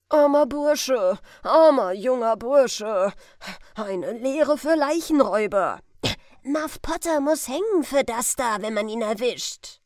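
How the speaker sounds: booming bystander gossip; loud crowd remarks. Acted. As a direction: call it out to the whole crowd. Crowd gawking at the corpse.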